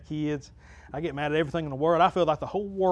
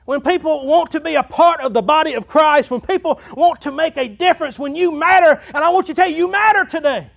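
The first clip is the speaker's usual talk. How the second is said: loud, high-pitched revival preacher; full-force preaching; 12 semitones higher, 12 dB louder than usual. Real, not acted. Pastor to church about everyone showing up, full-force preaching. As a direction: preach it out at the top of your voice.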